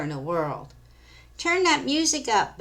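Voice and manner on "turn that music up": put-on valley girl voice. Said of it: "Bab says to Sherry".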